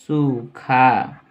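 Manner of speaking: सूखा stretched very long as "soo-khaa"; slow dictation. Shown as a demonstration. Teacher writing out the meaning of dry, slow syllabic dictation. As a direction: draw out both syllables of सूखा.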